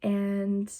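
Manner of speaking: ordinary talk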